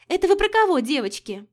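high, loud coquettish little voice; affected voice. A narrator's reading. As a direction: simper it, all coy. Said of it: "сжиманный голосок".

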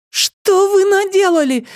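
very high, drawn-out shriek; horrified girl's high cry. A narrator's reading. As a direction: shriek it in horror, stretching the words. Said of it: "вскрикнула Нола".

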